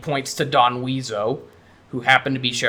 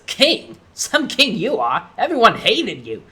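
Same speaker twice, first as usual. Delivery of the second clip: high, scornful mockery; scoffing; 7 semitones higher than usual. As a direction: scoff at his claim to the throne. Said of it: "Conker scoffs and says".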